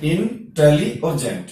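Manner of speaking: "इन, tele और gent" with a pause between the parts; syllable by syllable. Shown as a demonstration. Teacher splitting a word for students, "इन, tele और gent" said part by part. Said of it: "कितनी बार pause लेते हैं".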